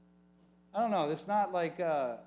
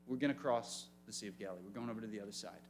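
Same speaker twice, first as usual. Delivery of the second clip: softer, calm, matter-of-fact promise; quietly recalled words; 9 semitones lower, 9 dB quieter, faster than usual. Retold or imitated. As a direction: say it softly as a calm reminder. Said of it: "What did he say".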